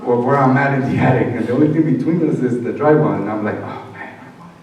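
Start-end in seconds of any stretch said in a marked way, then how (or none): 3.6-4.5 unvoiced hush, much softer; whispering. Hiding son whispering in the attic above his mom. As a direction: whisper so mom below can't hear.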